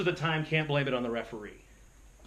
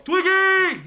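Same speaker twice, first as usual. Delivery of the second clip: high, loud shout of a nickname; yelling across arena; 12 dB louder than usual. Retold or imitated. Old friend spotting him at the NCAAs, loud high yell. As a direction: call out across a crowded gym. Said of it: "you hear somebody go".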